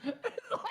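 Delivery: sudden high squeal of a laugh; high squeaky laugh. Real, not acted. Host laughing at the story, high squeal. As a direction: burst out in a high giggle.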